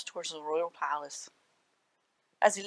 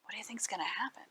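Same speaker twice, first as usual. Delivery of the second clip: whispered question; 12 dB quieter, much faster than usual. Real, not acted. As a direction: lean in and whisper it fast. Aside question to the children.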